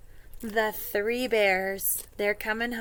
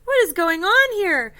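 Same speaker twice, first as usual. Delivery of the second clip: dismay; 7 semitones higher, 5 dB louder than usual. Acted. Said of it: "Mama Bear and Papa Bear are like".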